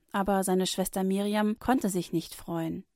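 ordinary delivery, nothing marked

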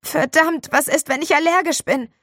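high, loud mock panic; faked panic call. Boy fakes allergy panic before the class, high loud call. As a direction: cry out as if truly frightened. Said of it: "rief er".

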